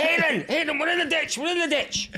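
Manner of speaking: very high, panicked squeal; retold screaming. Eyes-shut passenger to driver about ditch, mock screaming. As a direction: shriek a warning in comic terror.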